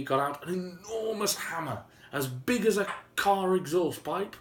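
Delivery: ordinary delivery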